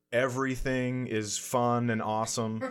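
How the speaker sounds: usual speaking voice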